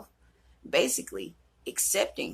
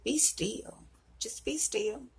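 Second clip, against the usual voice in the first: calm voice of God; 6 semitones higher, 3 dB quieter, slower than usual. Retold or imitated. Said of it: "he was like".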